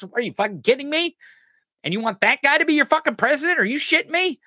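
high, outraged disbelief; incredulous. Real, not acted. Ranting at Trump supporters, high incredulous outrage. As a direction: ask it in sheer disbelief.

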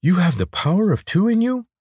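high-pitched, awed disbelief; astonished lizard. A narrator's reading. Lizard learns Corvin ate two seeds, astonished. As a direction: ask with pitch leaping in alarm. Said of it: "the lizard gasped".

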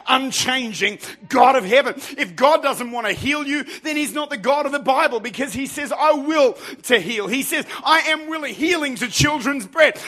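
emphatic, driving declarations; fervent revival preacher; impassioned preaching. Real, not acted. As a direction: build in pitch and force. Preaches at full force.

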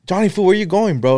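high, carrying call to a buddy; calling out to a friend. Retold or imitated. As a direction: shout across to your friend.

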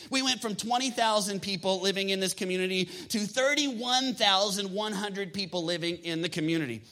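ordinary talk

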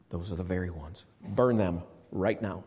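low, soft, cold order; a general coldly confirming to his officer, low and soft; grim command. Acted. Cortez repeats his order.

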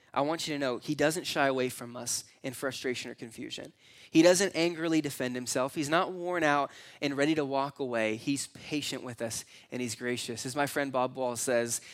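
usual speaking voice

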